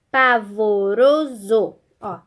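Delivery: "pavoroso" stretched out slowly, syllable by syllable; teacher slowly spelling out "pavoroso" in syllables. Shown as a demonstration. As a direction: draw out "pavoroso" so each syllable can be counted. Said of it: "tem quatro sílabas".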